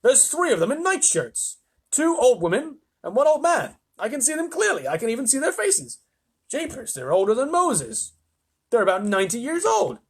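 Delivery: high, agitated protest; frantic insistence. A narrator's reading. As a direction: insist on what you can see. Disbelieved astronaut insists.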